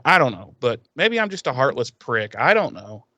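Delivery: ordinary talk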